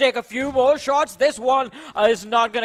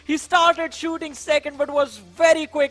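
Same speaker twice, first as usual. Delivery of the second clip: esports caster; 3 semitones higher than usual. Real, not acted. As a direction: celebrate the clutch for the crowd. Post-win casting.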